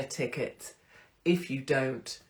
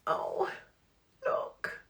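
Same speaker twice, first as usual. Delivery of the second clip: tearful whisper; 5 dB quieter than usual. Real, not acted. Whispering while crying.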